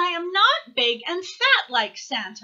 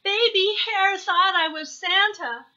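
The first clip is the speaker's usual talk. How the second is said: high, louder little owl gushing; bubbling childish report; excited crying out; 7 semitones higher, 5 dB louder than usual. A narrator's reading. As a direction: tell mama everything breathlessly, like an eager child. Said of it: "cried Baby Owl".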